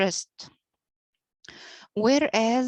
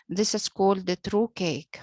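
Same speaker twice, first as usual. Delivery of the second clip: ordinary talk, nothing marked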